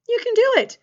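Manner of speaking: high, loud cheer; excited encouragement. Real, not acted. Teacher cheering a pupil on to finish, high and loud. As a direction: lift the pupil up with it.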